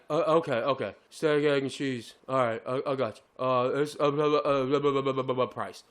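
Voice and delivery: low, mumbling cashier imitation; lightly mock a kid fumbling through the order; clueless order-taker. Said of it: "Sounds like a fucking moron".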